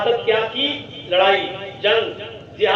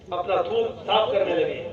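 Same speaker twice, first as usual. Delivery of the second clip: ordinary delivery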